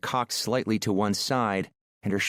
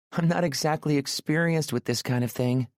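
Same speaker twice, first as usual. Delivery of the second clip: put-on higher, bright-eyed nervous young woman; voiced shy woman; 6 semitones higher than usual. A narrator's reading. Aurora agrees to go up and shower, nervously higher. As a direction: lift the pitch for shy Aurora.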